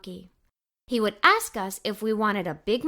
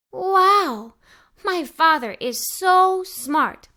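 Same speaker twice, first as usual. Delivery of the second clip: louder, higher wow, then slow admiring words; a kid dazzled by dad's clever plan; amazed wow; 4 semitones higher, 4 dB louder, much slower than usual. Acted. Child marvels at father's idea.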